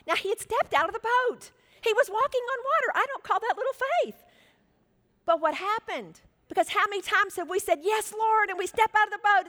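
high, loud, incredulous preaching; impassioned preaching. Real, not acted. Preacher defends Peter's little faith, impassioned. Preacher argues at full force.

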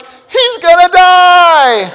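high, loud, slow laughing wail; mock-panicked dad. Dad watching his son on the slope, mock panic. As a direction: wail in comic terror. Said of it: "I'm like".

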